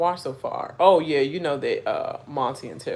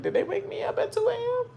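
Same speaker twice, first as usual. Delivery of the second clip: incredulous; faster than usual. Real, not acted. Outraged question about early wake-up.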